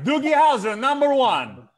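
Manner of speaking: put-on high, loud Russian-accent boast; mock Russian accent. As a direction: proclaim it in a comic Russian accent. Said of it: "He's like".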